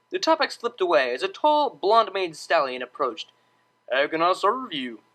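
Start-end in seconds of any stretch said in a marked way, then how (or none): 3.9-5.0 drawling waiter. A narrator's reading. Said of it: "he drawled".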